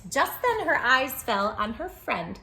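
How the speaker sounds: plain reading voice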